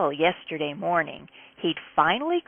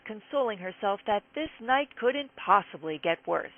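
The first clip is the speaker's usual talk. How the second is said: plain reading voice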